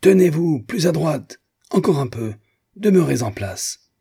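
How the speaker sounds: high, commanding drill shout; yelled orders. A narrator's reading. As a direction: bark orders at a subordinate. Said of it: "hurla le capitaine". (ordres criés avec autorité)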